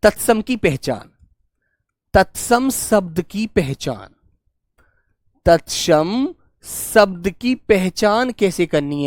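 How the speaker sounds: slow and deliberate, word by word; teacher dictating notes slowly; slow dictation. Shown as a demonstration. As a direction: dictate it slowly so they can write. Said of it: "अब इसकी एक पहचान और लिख लेना".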